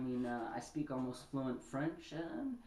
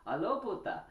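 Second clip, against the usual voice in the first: put-on a quick Spanish-accented hello, cocky; mock Spanish phone greeting; 7 semitones higher, 4 dB louder than usual. Guy picking up the phone to a girl, mock Spanish greeting.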